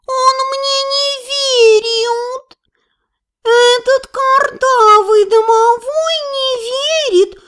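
high, whining cat yowl; roaring cat. A narrator's reading. Cat complains to the mistress, wailing high. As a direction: wail it slowly, stretching the vowels. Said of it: "взревел Филя".

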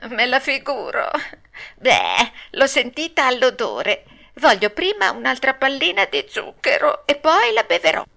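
high-voiced bargaining puppet; fussy Pinocchio. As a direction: haggle like a spoilt little puppet.

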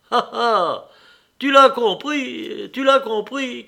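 loud, high, delighted cry; burst of joy. A narrator's reading. Nicolas cries to his brother that he understood, joyfully. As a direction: crow it out triumphantly, stretching the first one. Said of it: "cria Nicolas avec une explosion de joie".

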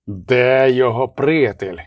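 slow, creaking menace; creaky villain voice. A narrator's reading. Henchman questions abbess about the prisoner's friend, creaky and slow. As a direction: creak it out slowly, putting on another voice. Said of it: "заскрипів він".

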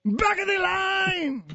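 high, slow mock holler; accented rant impression. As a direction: stretch it out in a high accented shout. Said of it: "It's the same accent".

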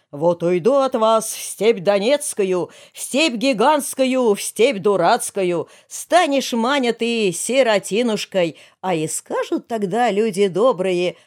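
slow, drawn-out sing-song threat; mock folk lament. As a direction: intone it like a wandering bard. Шуточное народное причитание героя. (причитая нараспев, как в былине)

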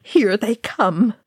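usual speaking voice

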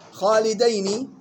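slow خالدين as "khaalidayni"; dual with fatha. Shown as a demonstration. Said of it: "فتح الدال للمثنى وتسكين الياء". (خالدين بفتح الدال وتسكين الياء)

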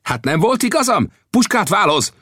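high, urgent shout across; calling out. Acted. Old Death calling to his companions as attackers close, urgent shout. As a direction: call over urgently, pitched right up. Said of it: "hogy oda kiáltsa nekünk".

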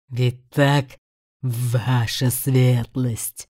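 low, slow menacing demon; taunting demon voice. Acted. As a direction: cruel beast savoring control. (зловещий голос демона)

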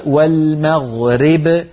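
والمغرب with the ra tilted toward i, slowly; والمغرب said slowly as walmaɣreb; wrong imala. Shown as a demonstration. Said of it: "ينطقونها راء ممالة". (والمغرب بإمالة الراء خطأ)

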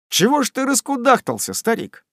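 a hint of cheeky son ribbing his father; teasing son. A narrator's reading. Sam teasing his fretting father, lightly mocking.

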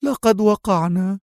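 high, drawn-out cry of dismay. A narrator's reading. Trapped woman cries out to her companion, high dismayed exclamation. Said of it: "فهتفت هي".

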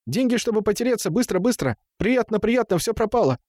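high, agitated gabbling; high distressed lament. A narrator's reading. Темул mourns his spilled money, high unhappy babble. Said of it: "Он с несчастным видом".

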